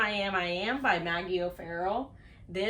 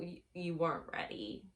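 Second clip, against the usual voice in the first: ordinary talk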